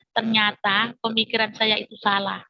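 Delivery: ordinary delivery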